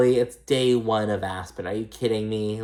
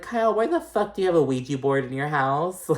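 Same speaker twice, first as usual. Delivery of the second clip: higher, incredulous mock outburst; mock disbelief; 6 semitones higher than usual. Speaker reacting to Kyle's Ouija board, mock incredulous. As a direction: ask it in playful disbelief. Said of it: "I'm like".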